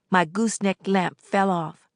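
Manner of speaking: plain reading voice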